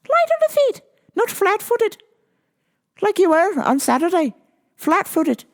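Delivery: high, put-on Irish accent. Coach reminding fighter of speed and power, Irish accent. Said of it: "Where's my Irish accent?".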